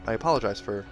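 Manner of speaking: plain reading voice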